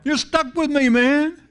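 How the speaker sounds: high, drawn-out preacher's God voice; comic high-pitched God; playful God. Acted. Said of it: "God says".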